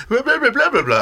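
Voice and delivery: very high babble of "blah blah"; mimicked gibberish phrase. Shown as a demonstration. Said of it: "so if someone does, like".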